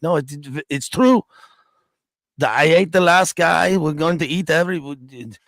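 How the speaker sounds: loud, high mock Haitian accent of a warlord. Warlord boasting in interview, loud mock Haitian accent. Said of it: "I can't do his Haitian accent".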